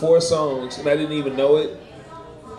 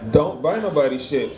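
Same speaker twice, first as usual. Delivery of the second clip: ordinary talk, nothing marked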